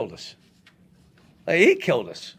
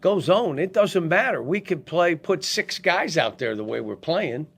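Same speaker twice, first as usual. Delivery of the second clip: mad staff; 4 semitones higher than usual. Retold or imitated. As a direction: imitate fed-up assistants venting. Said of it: "half the staff was so mad".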